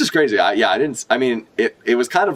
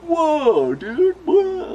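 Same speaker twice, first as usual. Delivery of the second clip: put-on awed kids; much slower than usual. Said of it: "They're all like".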